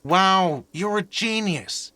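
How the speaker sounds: high, drawn-out mock admiration; sarcastic. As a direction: pretend to be amazed at their brilliance. Mocking guest who grasps it's money.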